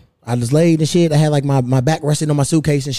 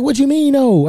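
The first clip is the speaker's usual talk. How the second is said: sharp high disbelieving "what you mean, no?"; incredulous; 11 semitones higher than usual. Retold or imitated. To the airline boss, retold disbelief. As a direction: pitch jumps up in disbelief. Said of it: "I'm like".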